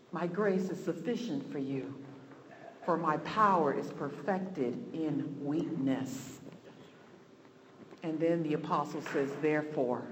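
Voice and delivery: soft, low preaching; hushed, reverent. Real, not acted. Preacher resting on scripture about grace, hushed and reverent. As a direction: bring it right down, quiet and low.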